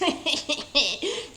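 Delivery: rising playful chuckle; pleased wolf chuckle. A narrator's reading. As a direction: chuckle to yourself happily. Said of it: "chuckled Harold".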